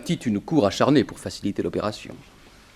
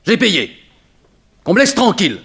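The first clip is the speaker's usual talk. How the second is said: rising indignant protest, louder and higher; 8 semitones higher, 8 dB louder than usual. Acted. Ex-thief defending himself to the detective, indignant. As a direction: snap back, offended at being accused. Accused man protests his past.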